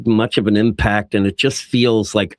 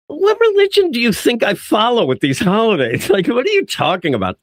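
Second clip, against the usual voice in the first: mock indignant; 9 semitones higher than usual. Voices Diana challenging Batman's holiday greeting.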